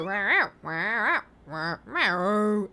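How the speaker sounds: repeated imitated cat yowls; cat yowl imitation. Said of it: "he always starts with a".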